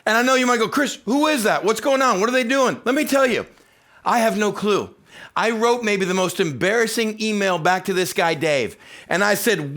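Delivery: high, loud, fervent preaching voice; booming excited sermon delivery; full-force preaching. Acted. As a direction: preach it big to the whole room. Preacher fired up after clip.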